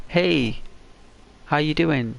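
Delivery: high cheery hello; friendly greeting. Pretend. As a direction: greet them like a harmless neighbour. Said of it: "like I do now".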